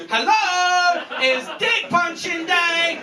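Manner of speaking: gleeful announcement. Retold or imitated. Salesman back at the door with boxing glove, gleeful high shout. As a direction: hail them with mock-festive glee.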